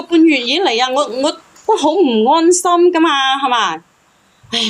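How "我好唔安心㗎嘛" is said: ordinary delivery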